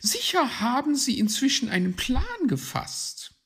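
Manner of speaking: high, loud magpie voice; high-pitched lady bird; confident magpie. Acted. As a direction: say it high and assured. Magpie reassures the group.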